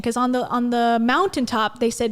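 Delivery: normal talking voice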